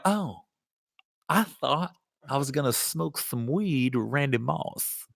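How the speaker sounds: quiet mock disappointment; mock disappointed. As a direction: mutter it like a let-down kid. Disappointed realization about weed.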